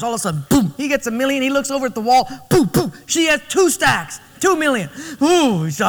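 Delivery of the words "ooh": dismayed ooh. Retold or imitated. Husband sees wife's double stack.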